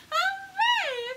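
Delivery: high, loud hooray; joyful shouted hooray. Acted. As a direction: cheer it out for the kids. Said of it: "do all three".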